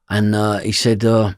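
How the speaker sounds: normal talking voice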